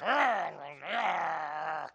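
loud, drawn-out "arr" growl; exaggerated miserable groaning noise; mock groan of misery. Mimics misery crawling out.

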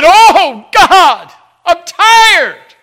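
very loud, high, imploring wail; anguished cry to God. Retold or imitated. Preacher as Jabez, tired of sorrow, crying out to God. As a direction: cry out at full force, worn down. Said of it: "He didn't just casually say".

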